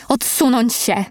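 understated shouted order; mild narrated yell. A narrator's reading. Miss Barnard orders the crowd back, a mild yell. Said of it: "panna Barnard wrzasnęła".

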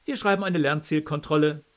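loud, high, cheery commanding tone; strict teacher. A narrator's reading. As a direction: bark orders at a class. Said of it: "in launigem Kommandoton begrüßte".

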